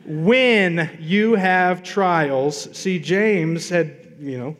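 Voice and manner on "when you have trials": When you have trials said very slowly, When held long; stretched out When, louder; slow emphasis on When. Shown as a demonstration. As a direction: drag out When for weight. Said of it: "what that word means".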